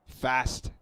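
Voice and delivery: usual speaking voice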